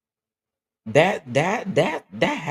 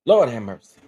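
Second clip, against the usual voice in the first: overwhelmed; 2 semitones lower, 5 dB louder than usual. Real, not acted. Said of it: "I'm together".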